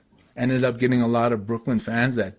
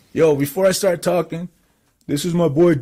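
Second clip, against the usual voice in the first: raised, louder retold speech without the full impression; first attempt at Ernie; 6 semitones higher, 5 dB louder than usual. Ernie introducing the rapper, imitation not yet in full voice. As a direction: start introducing a friend in a high voice. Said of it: "hold on, let me get my Ernie voice".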